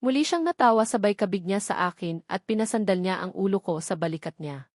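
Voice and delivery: ordinary delivery, nothing marked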